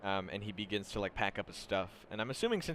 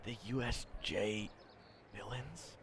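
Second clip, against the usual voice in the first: soft, fading almost to nothing; hushed awe; 10 dB quieter than usual. Acted. Student leaning in to ask about villains, hushed. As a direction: lean in and drop to a murmur.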